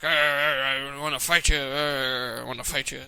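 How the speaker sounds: loud, low growling brawler; South Park Russell Crowe. Acted. Demonstrating a Russell Crowe impression for co-host, loud low growl. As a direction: growl it like a cartoon tough guy. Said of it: "Just do the South Park version".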